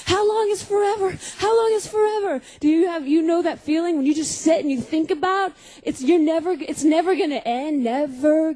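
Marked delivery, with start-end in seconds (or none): 0.0-2.4 put-on high, urgent child questions; a small kid bursting in, panicked, asking Mom; five-year-old running to Mom in a high excited panic; freaking out. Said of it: "and I'm freaking out".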